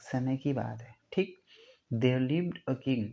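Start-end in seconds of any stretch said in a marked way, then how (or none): 1.9-3.0 slow dictation. Shown as a demonstration. Reads example sentence slowly.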